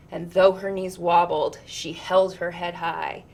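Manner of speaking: ordinary delivery, nothing marked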